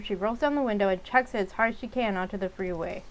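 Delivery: ordinary delivery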